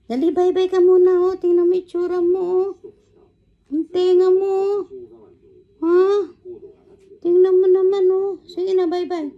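very high-pitched cooing to a pet; coaxing pet talk. Real, not acted. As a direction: urge the pet along in a high little voice.

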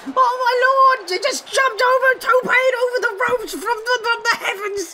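high-pitched, breaking into awe; astonished commentator cry; amazed play-by-play. Real, not acted. Calling an amazing leap over ropes.

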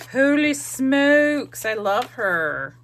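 loud, drawn-out delighted exclaim; excited. Real, not acted. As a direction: gush over her, slowly and loudly. Delighted at her collage.

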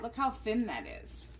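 usual speaking voice

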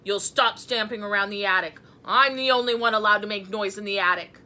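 loud, emphatic ordering; booming, bossy telling-off; stern scolding. A narrator's reading. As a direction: bellow the rule, asserting authority. Gus keeps scolding Mouse.

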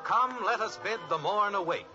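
ordinary talk, nothing marked

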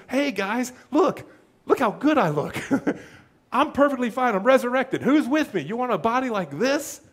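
lightly voiced cheerful boaster, half-laughing; mock Jesus showing off. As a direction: play him bragging, a hint of showman. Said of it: "he says".

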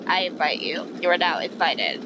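usual speaking voice

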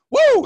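high, loud whoop of hype; excited whoop. Real, not acted. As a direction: let out a fired-up cheer. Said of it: "Got fired up right there".

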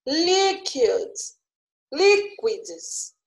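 "liquids" with LIQ held long, pitched high; stress on first syllable. Shown as a demonstration. Teacher showing the longest syllable is first, stretched high LIQ in "liquids". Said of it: "a mais demorada foi a primeira".

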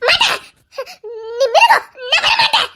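very high cry of refusal; terrified defiance. Acted. Frightened girl screaming that she won't come to him.

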